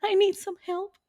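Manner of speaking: high, soft heartbroken voice; mock pitiful caller. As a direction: whimper it like a lovesick kid. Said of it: "I can imagine the call".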